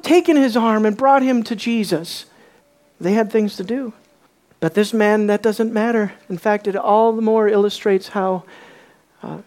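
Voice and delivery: usual speaking voice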